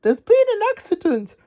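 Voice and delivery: very high urgent voice; high, panicked Dizzy; alarmed cry. Acted. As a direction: cry out the bad news. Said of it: "cried Dizzy".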